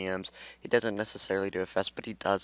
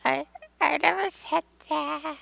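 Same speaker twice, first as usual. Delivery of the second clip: high squeak. Acted. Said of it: "she hissed".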